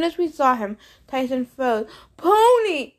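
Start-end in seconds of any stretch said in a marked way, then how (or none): 2.2-2.9 high, loud, thrilled shout; cry of rapture. A narrator's reading. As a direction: burst out in pure delight. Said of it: "he cried in a tool rapture".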